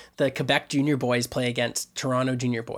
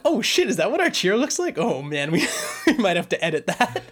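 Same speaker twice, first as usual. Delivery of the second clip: higher, laughing mock alarm at the cheer; laughing disbelief; 7 semitones higher than usual. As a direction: play up the shock with a laugh. Retelling own shocked reaction watching stream.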